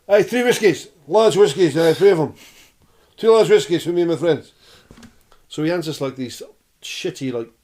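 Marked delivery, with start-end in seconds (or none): 0.1-4.5 loud Scottish bar order, pitched up; Scottish accent. Retold or imitated. Old Scot ordering from the bar for new friends, loud Scottish accent. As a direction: holler for service like an old Scot. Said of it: "this old Scottish guy at the bar".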